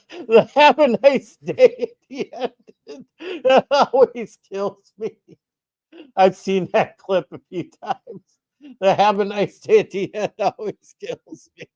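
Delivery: talking through real laughter; laughing. Real, not acted. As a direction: laugh while you say it, voice up high. Laughing at a replayed clip.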